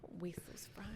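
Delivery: whispered aside; urging a friend not to waste fries, whispered. Real, not acted. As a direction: breathe it as a hushed plea. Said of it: "whispered aside".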